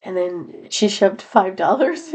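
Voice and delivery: usual speaking voice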